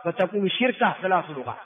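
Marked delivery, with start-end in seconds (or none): 0.5-0.9 شركة with kasra moved onto the shin; moved vowel. Shown as a demonstration. Teacher showing the moved vowel, "shirka". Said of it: "بنقل حركة الوسط إلى الفاء".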